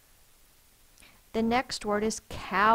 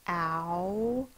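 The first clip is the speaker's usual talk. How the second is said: ordinary delivery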